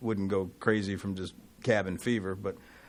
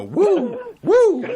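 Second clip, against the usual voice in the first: Ric Flair impression; 10 dB louder than usual. Imitating Ric Flair's woo.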